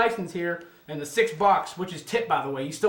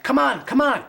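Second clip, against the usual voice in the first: high, urgent hurry-up; pushing him to hurry, pitch up; urgent retold plea; 8 semitones higher, 5 dB louder than usual. Friends at the car urging the driver, high and urgent.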